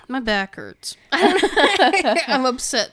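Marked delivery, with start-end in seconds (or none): 1.0-2.9 laughing. Real, not acted. Laughing about sore back.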